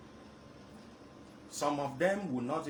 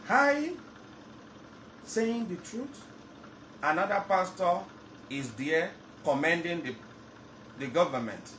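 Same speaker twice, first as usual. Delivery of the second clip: passionate loud outburst; 6 semitones higher, 5 dB louder than usual. Real, not acted. Passionate outburst on radio call-in.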